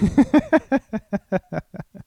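long, high cackle; real laughing fit at own joke. Real, not acted. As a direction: crack up in a drawn-out staccato laugh.

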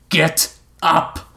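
forceful shouted order, word by word; shouting. A narrator's reading. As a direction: punch each word as a command. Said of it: "Tiger continued to shout in his ear".

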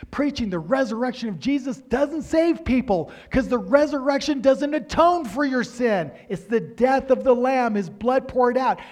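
high, loud, driving sermon delivery; preaching at full force. Acted. Pastor to congregation on the Lamb's death, preaching at full force.

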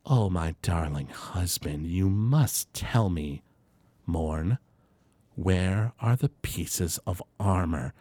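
put-on slow, low, silky older woman; lightly voiced menacing older woman; older dragonborn woman. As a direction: coax him slowly as his would-be wife. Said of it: "And she goes".

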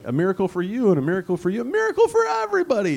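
high, fast mocking showman voice; mock giveaway. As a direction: hand out miracles like a game show host. Mocking miracles handed out freely.

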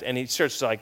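ordinary delivery

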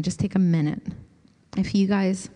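soft, unhurried voice easing them into stillness; guided meditation. Acted. Pastor guiding the group into quiet prayer, soft meditation voice. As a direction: let each phrase settle before the next.

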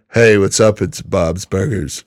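low cartoon burger-cook voice; H. Jon Benjamin. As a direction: drop low, easy actor impression. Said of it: "so I've been working on my".

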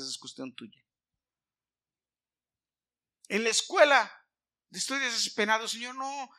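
loud, high, impassioned preaching; fervent preaching. Acted. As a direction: preach it with full fire. Preaching at full force.

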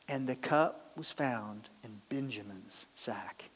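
slow hushed whisper of the reveal; dramatic whisper. A narrator's reading. Narrator revealing the cup in Benjamin's sack, slow whisper. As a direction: let the twist drop in a whisper.

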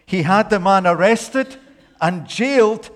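high, loud dramatic preaching; storytelling at full force. Acted. Preacher acting out the parable, in a loud high telling. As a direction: tell it big and breathless, building.

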